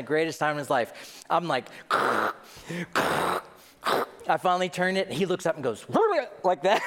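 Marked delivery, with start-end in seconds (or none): 5.9-6.4 a high startled yelp; squeaky kid yelp; high squeal. Retold or imitated. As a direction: imitate the kid's squeak. Boy looks up, reacts.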